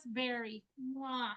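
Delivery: ordinary talk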